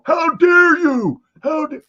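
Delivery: high, slow indignant cry; shocked how-dare-you yell, mock; mock outrage. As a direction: play the outraged senator, hammed up. Mock outraged senator standing up.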